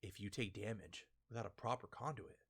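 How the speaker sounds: low, soft, menacing murmur; Killer's cold stare. Retold or imitated. Killer coldly threatening Hawkins, low and soft. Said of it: "He just stares like".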